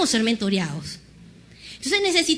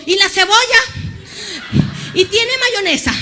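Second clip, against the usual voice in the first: higher, louder nervous stalling; flustered dodge; 6 semitones higher, 8 dB louder than usual. Retold or imitated. Preacher dodging God's order by asking the shopkeeper, flustered. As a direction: blurt questions to dodge, high and loud.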